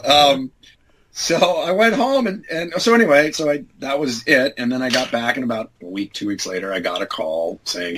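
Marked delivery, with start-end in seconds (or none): none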